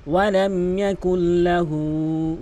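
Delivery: ইয়াকুল্লাহু with হু held one alif long. Shown as a demonstration. Teacher showing the elongated হু, slowly. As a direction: stretch the হু in ইয়াকুল্লাহু. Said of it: "হু কিন্তু এক আলিফ টানবেন".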